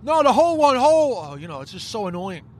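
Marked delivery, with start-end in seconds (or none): 0.0-1.2 high, stretched-out calling; mock frustrated customer; exasperated correction. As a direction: correct them through the glass. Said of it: "then they come back with".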